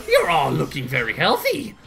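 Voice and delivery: a hint of a smug villain threat; villain Tuxedo Mask. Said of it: "Tuxedo Mask, if he were a villain ... he goes".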